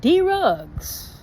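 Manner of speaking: D-Rugs spelled out as two beats, dee-rugs; D-Rugs drawn out for comic effect. Retold or imitated. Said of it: "D-Rugs".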